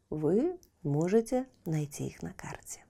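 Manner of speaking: ordinary delivery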